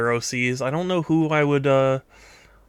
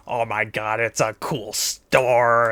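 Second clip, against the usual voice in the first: slow awed murmur; 3 semitones lower, slower than usual. Pretend. Voicing awed character in picture.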